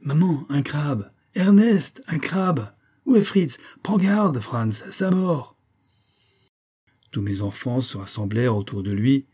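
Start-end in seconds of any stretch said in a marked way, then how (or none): 0.0-5.6 put-on high, excited boy calling out to everyone; crying out. A narrator's reading. Jack running to his mother with the crab, crying out. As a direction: shout the alarm in a high voice. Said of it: "se mit à courir vers sa mère en criant".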